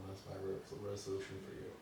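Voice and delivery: ordinary delivery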